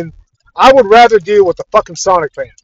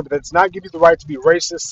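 ordinary delivery

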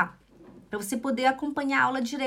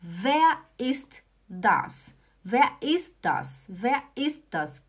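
drawn-out German, word by word; teacher spelling it out slowly; slow model phrase; much slower than usual. Shown as a demonstration. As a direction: say it slowly so learners catch each word. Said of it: "primeiro eu falo".

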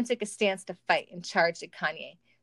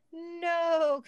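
long, high-pitched no; mock anguished cry; 9 semitones higher than usual. A narrator's reading. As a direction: wail it out, drawn long. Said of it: "Kanye stuttered".

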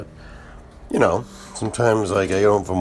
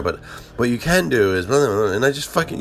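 ordinary talk

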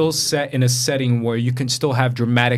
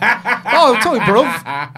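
put-on high, loud British street accent; mock British slang; 10 semitones higher, 5 dB louder, slower than usual. Host making co-hosts laugh, exaggerated British slang accent. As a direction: ham up the British gangster slang. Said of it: "they just say bruv".

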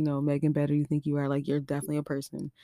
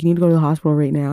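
loud, fast urgent command; urgent order; 11 dB louder, much faster than usual. Retold or imitated. Poison control telling her to go now, loud fast urgency. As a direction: rush her to the hospital.

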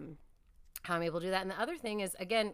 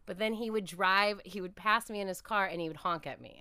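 ordinary talk, nothing marked

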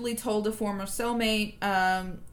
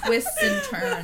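laughing. Real, not acted. Still laughing at the story.

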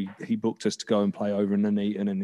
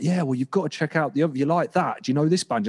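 higher-pitched, quick-fire enthusiasm; eager recommending; 6 semitones higher, faster than usual. Retold or imitated. Bandmate talking music after the show, imitated eager recommendation. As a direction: imitate a keen friend pushing a band.

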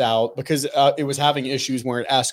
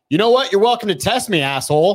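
higher and louder, squaring up; defiant comeback; 6 semitones higher, 3 dB louder than usual. Retold or imitated. Retelling how she faced down the smug gun store clerk, defiant and loud. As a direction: throw the challenge back at him, higher and louder. Retold standoff with rude clerk.

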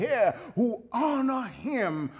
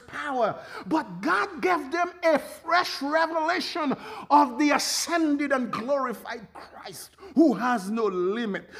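fiery preaching; 6 semitones higher, 3 dB louder than usual. Acted. Preacher building to shout.

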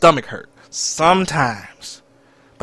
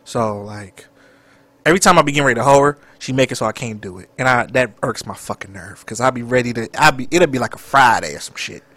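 normal talking voice

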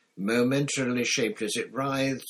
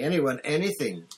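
louder, higher urgent plea; desperate thought; 7 semitones higher, 5 dB louder than usual. A narrator's reading. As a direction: plead for any help at all. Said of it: "he thought desperately".

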